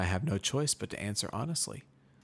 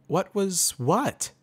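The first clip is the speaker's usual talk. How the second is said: high, loud confused pony; a much higher, startled mare asking back; 11 semitones higher, 6 dB louder, much slower than usual. Acted.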